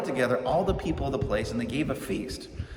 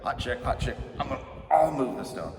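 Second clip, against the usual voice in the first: hot chick. Retold or imitated. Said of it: "So now he's like".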